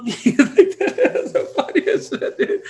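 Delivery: slow, high, laughing words. Real, not acted. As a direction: dissolve into laughter. Laughs at the memory.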